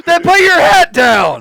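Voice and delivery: high, loud comeback; playful shout. Real, not acted. Host ordering co-host to drop the hat, shouted. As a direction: shout it back in mock outrage. Shouting back at throwing threat.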